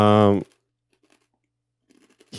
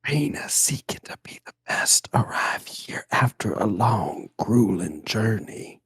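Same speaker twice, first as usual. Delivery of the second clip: slow whispered ASMR in an accent; 8 dB quieter than usual. Shown as a demonstration. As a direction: whisper it close like ASMR, drawn out. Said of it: "If you do A S M R".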